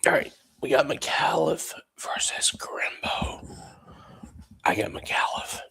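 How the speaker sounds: hushed whisper so as not to wake anyone. Real, not acted. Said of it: "I know this is strange that I'm whispering".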